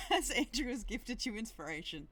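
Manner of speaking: real laughter through the words; helpless giggling delivery; laughing. Real, not acted. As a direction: break up laughing while announcing it. Game master cracks up at inspiration.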